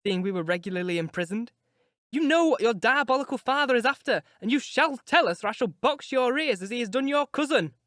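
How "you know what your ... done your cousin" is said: higher and louder, scolding hard; angry threat. Acted. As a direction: threaten to box his ears. Nelly threatens Linton.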